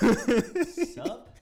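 high, loud, exaggerated dude hello; hammed-up cool greeting. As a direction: ham up the awkward greeting.

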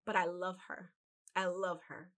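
soft, choked-up words of love; real tender catch in the voice; holding back tears. Real, not acted. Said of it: "I am not gonna cry".

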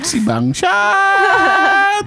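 high drawn-out laughing yell; laughing mock shout. Real, not acted.

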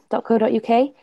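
ordinary delivery

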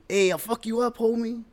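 high, loud tough-guy parody; high loud hammed-up threat; mock Dodger fan threat. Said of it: "that's how it would sound".

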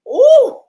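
loud high-pitched "ooh"; stung "ooh". Retold or imitated. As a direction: whoop it as if hit by a harsh blow. Retold reaction to being thrown out.

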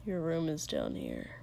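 low and flat, no feeling in it; low, emotionless. A narrator's reading. Katsuki to Shoto in the hallway, low and emotionless. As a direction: hold every feeling back, low. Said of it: "tone low and emotionless".

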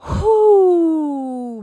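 high, loud whoo sliding down; big steadying whoo; bracing exhale. Retold or imitated. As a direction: let out a long falling whoo before something hard. Bracing to read list to daughter.

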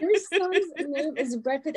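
slow, high, disbelieving repeat; slowly repeating the name in disbelief; stunned disbelief. Retold or imitated. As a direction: spell out the absurd name slowly.

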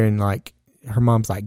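ordinary talk, nothing marked

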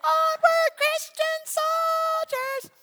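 slow high mock hymn voice; mock churchy voice. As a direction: ham up a pious choirboy. Mocking churchy stereotype.

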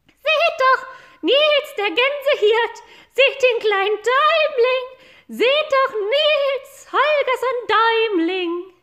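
very high, loud squeaky bird voice; shrill sparrow. Acted. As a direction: call out like a mocking little sparrow. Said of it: "da rief er auch schon".

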